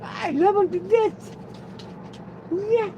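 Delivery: tiny high falsetto, rising; doting baby talk. Pretend. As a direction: gush it in a squeaky falsetto.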